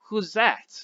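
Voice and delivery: put-on light French accent on a gruff question; a hint of French, asking who the stranger is; faint French accent. A narrator's reading. Tough man in the truck asks about the newcomer, faint French accent. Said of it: "He spoke with a faint French accent".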